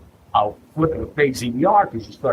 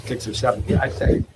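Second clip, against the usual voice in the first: normal talking voice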